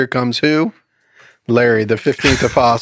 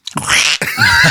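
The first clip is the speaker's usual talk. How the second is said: very high, sudden comic squeal; mock squeal. Joke's woman stepping on a duck, squeal. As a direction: squeal as the lady in the joke. Asked to do her voice.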